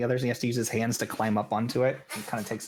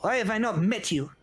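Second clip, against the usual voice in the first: put-on tiny old gnome scolding the brawlers; stern little halfling; 8 semitones higher than usual. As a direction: scold them like a tiny elder. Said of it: "turns around and says".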